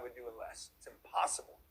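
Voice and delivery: intense whisper. Real, not acted. Coach confiding to his audience in a whisper. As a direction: drop to a whisper to drive it home.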